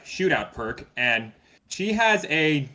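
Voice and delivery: ordinary talk, nothing marked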